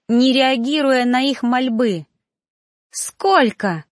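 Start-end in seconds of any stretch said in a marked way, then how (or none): 2.9-3.8 one drawn, indignant bark; barked demand. A narrator's reading. Trainee steps up to the reaper, barking angrily. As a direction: bark the question as an accusation. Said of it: "рявкнула я".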